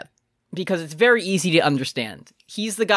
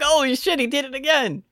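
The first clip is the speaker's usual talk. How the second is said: amazed; 8 semitones higher than usual. Retold or imitated. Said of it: "you're like".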